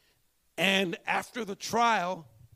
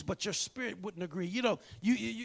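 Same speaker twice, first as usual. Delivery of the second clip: ordinary talk, nothing marked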